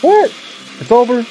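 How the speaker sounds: sudden high disbelief; surprised. Real, not acted. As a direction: caught off guard, voice jumps up. Show ends suddenly.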